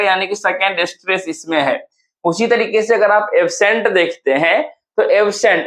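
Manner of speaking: ordinary talk, nothing marked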